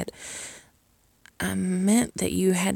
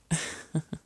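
soft giggle; 5 dB quieter than usual. Acted. Teasing offer.

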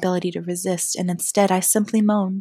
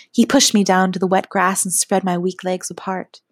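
ordinary delivery, nothing marked

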